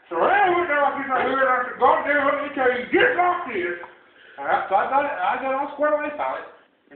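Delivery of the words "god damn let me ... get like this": high, raised-voice scolding; acted-out exasperated shout; worked-up telling-off. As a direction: lay down the law, voice raised.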